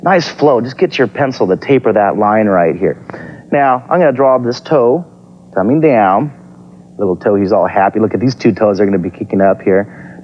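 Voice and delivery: ordinary delivery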